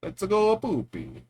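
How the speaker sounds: slow, hammy mock Italian accent. Shown as a demonstration. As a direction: drag out the Italian. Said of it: "Uh, that was awful".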